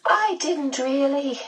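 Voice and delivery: high, slow, fluting medium voice; high-voiced, apologetic medium; dismayed medium. A narrator's reading.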